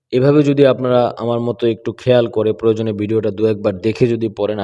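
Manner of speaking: usual speaking voice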